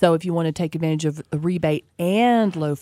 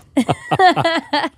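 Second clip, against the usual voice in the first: real laugh; 10 semitones higher, 5 dB louder than usual. Real, not acted. Laughing at the Holden Commodore name.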